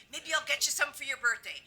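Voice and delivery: ordinary talk, nothing marked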